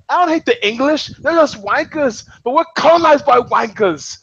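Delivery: put-on high, worked-up Scottish accent; hammed-up emotional Scottish rant; Ewan McGregor. Quoting the rant about the English, mock-emotional Scottish. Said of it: "he just gets really, like, emo about it".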